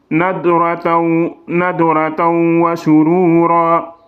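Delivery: slow chant, nadrataw ending on a clear waw sound; Quranic recitation. Shown as a demonstration. Teacher reciting for students, slow and melodic. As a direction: draw out nadrataw wa surura melodically. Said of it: "Il faut toucher le waw".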